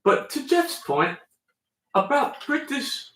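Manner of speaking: put-on mid-Atlantic accent. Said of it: "mid-Atlantic accent".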